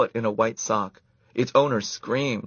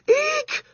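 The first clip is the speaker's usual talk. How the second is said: put-on shrill girlish eek; high squeal; 5 dB louder than usual. A narrator's reading. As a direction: squeal in fright at a mouse. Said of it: "squealed Karen".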